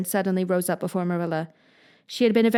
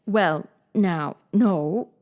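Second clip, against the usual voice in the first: uneasy; much slower than usual. A narrator's reading. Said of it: "said Matthew uneasily".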